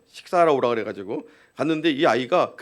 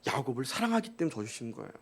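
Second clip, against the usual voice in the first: quiet emphasis; 4 semitones lower, 13 dB quieter than usual. Acted. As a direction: hush the line, leaning on '사랑하기'. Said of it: "repeated phrase, quiet take". ('사랑하기'를 아주 부드럽게 강조)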